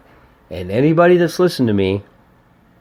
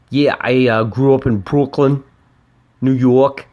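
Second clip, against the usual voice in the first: put-on a passable New York Brooklyn accent, a bit louder; lightly put-on Brooklyn accent, imitating the band; 4 semitones higher, 4 dB louder than usual. Podcaster imagines the band talking, in a mock Brooklyn accent. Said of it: "that's the best I could do as far as an accent".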